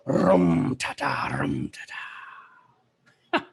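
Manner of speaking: slow, low trilled "rum ta ta" chant; bard strumming his harp. Acted. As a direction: roll out a bard's rhythm, sinking very low. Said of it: "he would be strumming".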